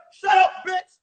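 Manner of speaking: loud, high mock shout; cursing student. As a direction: heckle the teacher at full volume. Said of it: "We would curse her out".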